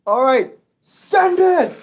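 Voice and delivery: loud, high, drawn-out shout; high, whooping launch call; excited call-out. Real, not acted. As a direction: send it off with a big slow yell. Calls out sending train off.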